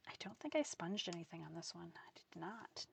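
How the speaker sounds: hushed, whispered aside; softly whispering while looking the card over; whisper to self. Real, not acted. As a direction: whisper it as you check the card. Checking quietly to herself.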